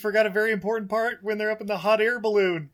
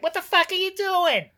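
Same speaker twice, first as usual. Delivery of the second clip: high-pitched impression of a far-off yell; Joey Pants; 11 semitones higher than usual. Podcaster imitating an actor shouting from far away, high yell. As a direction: call it out high, as if from miles away. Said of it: "That, that was Joey Pants from about four hundred miles away".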